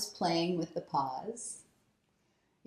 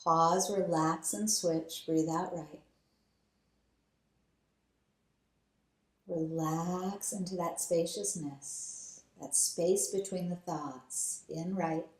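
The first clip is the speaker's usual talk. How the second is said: guided breathing meditation. Acted. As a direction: lead them gently through each breath. Leads alternate nostril breathing.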